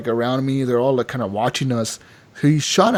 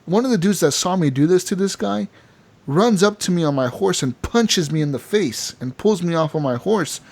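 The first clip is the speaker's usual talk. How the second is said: normal talking voice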